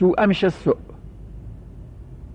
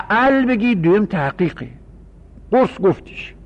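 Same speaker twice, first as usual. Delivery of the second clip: ordinary delivery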